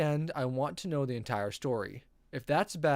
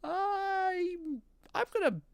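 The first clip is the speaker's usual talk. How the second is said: stunned; much slower than usual. Real, not acted. Stunned reaction.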